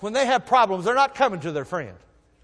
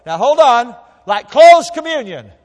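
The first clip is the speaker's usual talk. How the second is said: slow, much louder punch on closed communion; emphatic preaching; 6 semitones higher, 13 dB louder than usual. Acted. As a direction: stop them and hit it hard. Preacher stops and stresses point.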